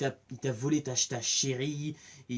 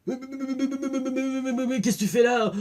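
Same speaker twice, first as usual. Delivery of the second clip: high, stuttering panic on each mais; stammering shock; 9 semitones higher than usual. Retold or imitated. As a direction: sputter like you've seen a ghost. Dwight shocked to see Negan.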